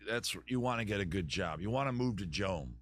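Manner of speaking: normal talking voice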